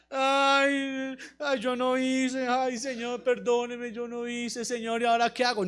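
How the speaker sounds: low, soft, drawn-out lament; mock whimpering. Joshua as he could have stayed, mock whimpering to the Lord. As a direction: whine it slowly, playing helpless. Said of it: "ahí lloriqueando".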